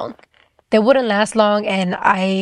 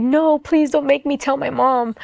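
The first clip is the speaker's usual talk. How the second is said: higher, imitating a tearful plea; tearful pleading; 5 semitones higher than usual. Retelling her prayer to God, mildly teary and pleading. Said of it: "And I would just cry".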